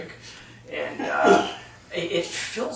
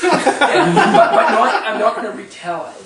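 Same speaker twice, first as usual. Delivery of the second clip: laughing, much higher and louder; 7 semitones higher, 12 dB louder than usual. Real, not acted. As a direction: break into laughter as you reassure them. Audience groans, speaker laughs.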